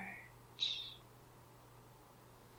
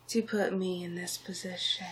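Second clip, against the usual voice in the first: usual speaking voice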